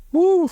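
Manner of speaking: high, loud whoop of delight; a big excited woo. Acted. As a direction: let out a joyful woo. Whoops at million-dollar offer.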